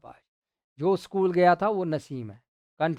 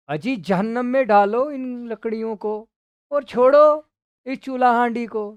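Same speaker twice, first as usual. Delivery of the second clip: raised, higher dismissive outburst; mock irritated husband; 6 semitones higher, 6 dB louder than usual. Munshi ji telling his wife to drop the cooking, raised voice. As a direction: wave her off loudly.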